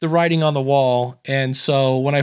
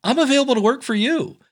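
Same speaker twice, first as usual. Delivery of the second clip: eager pitch; 10 semitones higher, faster than usual. Retold or imitated. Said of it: "And I go".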